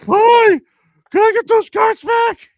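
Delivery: very high, loud squeaky shout; Bubbles voice. Retold or imitated. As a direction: chirp it like a tiny excited kid. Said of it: "Why do I sound like Bubbles".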